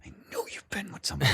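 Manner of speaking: a knowing, suspicious whisper; accusing whisper. Retold or imitated. Girlfriend catching her man cheating, knowing whisper. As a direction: hiss it like she's caught you. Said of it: "a girlfriend that's like".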